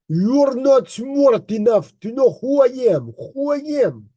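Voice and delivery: mocking take on a Russian accent. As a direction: mimic his boast high in a Russian accent. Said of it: "try to even say it in his accent".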